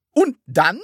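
high, loud, pressing question; urging. A narrator's reading. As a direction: push eagerly for the rest. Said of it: "drängte sie". (drängend)

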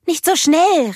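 high, loud anxious warning; big sister fearing for her sister; worried call. Acted. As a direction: call out in alarm. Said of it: "rief Elsa besorgt".

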